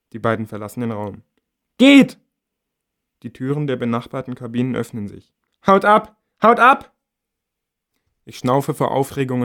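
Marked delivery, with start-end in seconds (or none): 1.8-2.2 very high, loud scream; loud high shriek of Geht. A narrator's reading. Narrator yelling them out. 5.7-6.9 high, loud repeated scream; loud high yells of Haut ab. A narrator's reading. Narrator yelling them away.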